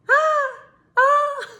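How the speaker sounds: very high, loud birdlike "ah"; badly faked moans, squealed high like a bird; fake moan. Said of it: "you have to fake it".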